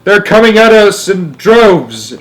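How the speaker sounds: high, loud, drawn-out report; shouting. A narrator's reading. Astronaut radioing the president under attack, shouting. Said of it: "Shuckworth was shouting".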